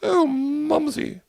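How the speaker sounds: put-on very high, loud, slow falsetto old lady. Host teases co-host's mother in a high slow old-lady falsetto. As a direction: croon at her like a doting old biddy. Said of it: "do Mumsy".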